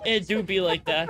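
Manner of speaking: ordinary talk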